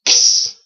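X as a voiceless "ksss"; X-like sound. Shown as a demonstration. Teacher blending the two Cs, "ks" sound. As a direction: run K into a hissed S for X. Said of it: "a sound similar to the X".

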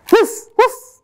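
playful woof. Acted. Greeting a pizza dog.

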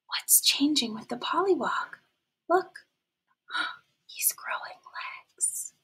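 gasping hushed whisper; excited whisper. Acted. Teacher revealing to kids, awed whisper. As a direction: whisper it with wonder.

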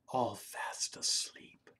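slow, soft whispered ending; sleepy whisper. A narrator's reading. Reading the bedtime line about sleeping cars, whispered. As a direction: tuck it in with a whisper.

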